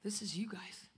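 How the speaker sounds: hushed, intimate sermon whisper. Acted. Preacher telling the congregation they are the rod, whispered. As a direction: drop to a whisper so they lean in.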